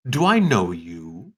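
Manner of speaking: slow, sidelong question from the mage; suspicious mage. Acted. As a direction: ask it slowly, narrowing your eyes. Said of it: "the bonds mage looks at you, side, and goes".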